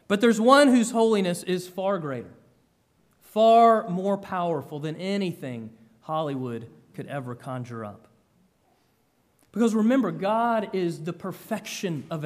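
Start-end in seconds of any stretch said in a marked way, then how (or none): none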